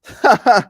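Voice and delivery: loud burst of laughter; real laugh. Real, not acted. Reacting to Russell's contradiction.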